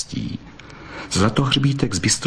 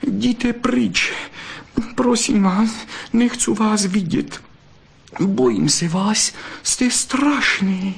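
much higher, trembling plea, slow at the end; frightened pleading; 12 semitones higher, slower than usual. A narrator's reading. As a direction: push him away out of fear. Vixen begs him to leave.

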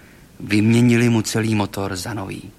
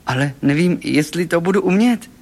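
higher-pitched excited admission; Golfík, eager; 7 semitones higher, 3 dB louder than usual. A narrator's reading. Talking car unsure it can sing, eager high voice. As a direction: blurt the doubt out, pitch climbing. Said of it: "vyhrkl nadšeně Golfík".

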